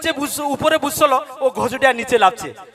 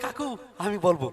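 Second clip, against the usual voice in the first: put-on soft, small child's voice, lower and quieter; little girl voice; 6 semitones lower, 9 dB quieter than usual. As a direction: play a shy little girl asking to answer. Child voiced in comic story. (ছোট মেয়ের কণ্ঠ)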